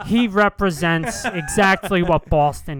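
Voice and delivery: Boston broadcaster. Pretend. The Boston announcer gushing about Pedroia, mild Boston voice. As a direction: praise the local hero like a Boston announcer. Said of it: "you be him".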